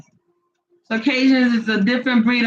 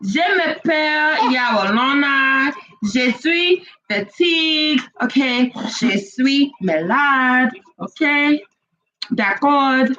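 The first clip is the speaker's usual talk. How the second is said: hammed-up French. Playful French phrases.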